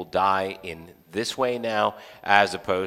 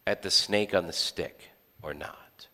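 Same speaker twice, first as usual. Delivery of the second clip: soft unvoiced whisper; hushed whisper to the congregation; 4 semitones lower, 8 dB quieter than usual. Real, not acted. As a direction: lean in and whisper it. Sermon point, dropped to a whisper.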